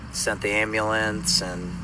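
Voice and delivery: ordinary talk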